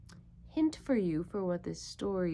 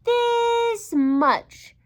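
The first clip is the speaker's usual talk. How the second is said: much higher, loud proud cry; little hare exclaiming; 14 semitones higher, 10 dB louder than usual. Acted. Little hare shows big hare how much he loves him, proud. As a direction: burst out proudly like a small child. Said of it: "said Little Nut Brown Hare".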